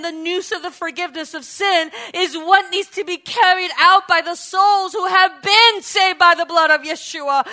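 ordinary delivery

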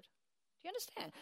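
ordinary talk

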